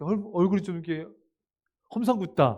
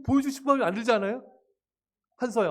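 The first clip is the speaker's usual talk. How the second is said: ordinary talk, nothing marked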